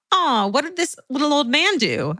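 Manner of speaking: high, pitying coo; cooing inner thought. Retold or imitated. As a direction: coo over a harmless little old man. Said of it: "I thought to myself".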